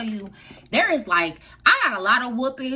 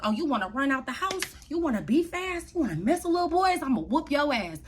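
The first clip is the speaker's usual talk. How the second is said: lightly voiced scolding parent, higher pitch; mock scolding father; 6 semitones higher, faster than usual. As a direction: rattle off taunting questions as an imitated angry dad.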